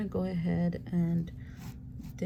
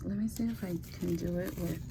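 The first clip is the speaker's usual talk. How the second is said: quiet at night; 6 dB quieter than usual. Real, not acted. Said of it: "my son is asleep".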